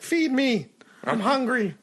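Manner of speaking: high, pleading pet voice; hungry talking pet. Acted. Pet demanding food from the owner, hungry whine. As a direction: beg in a squeaky little pet voice. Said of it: "be like".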